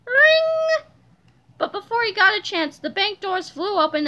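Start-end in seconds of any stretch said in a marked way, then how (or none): 0.1-0.9 high, loud sustained ring; imitated bell ring. A narrator's reading. Bank alarm going off, ringing high and loud.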